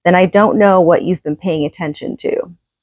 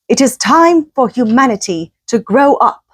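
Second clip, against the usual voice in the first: put-on English accent; 6 semitones higher than usual. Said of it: "I'm gonna try and do my English accent".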